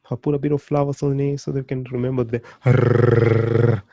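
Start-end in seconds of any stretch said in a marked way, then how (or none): 2.7-3.8 long, forceful trilled R; R stretched into a rolling trill; rolled R. Shown as a demonstration. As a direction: hold a loud tongue-roll on R. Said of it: "We've got the rolling R".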